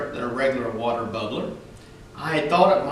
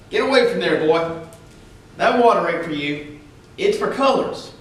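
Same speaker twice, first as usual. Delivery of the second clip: put-on loud gruff order to a child; stern policeman; 3 semitones higher, 5 dB louder than usual. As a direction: bark it like an angry cop. Policeman orders boy off fountain.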